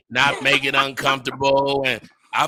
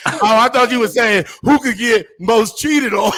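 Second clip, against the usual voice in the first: high, loud, cracking up; amused protest through laughter; laughing; 8 semitones higher, 5 dB louder than usual. Real, not acted. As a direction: laugh right through the words. Cracking up at his misunderstanding.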